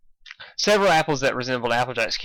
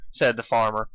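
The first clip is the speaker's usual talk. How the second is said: ordinary delivery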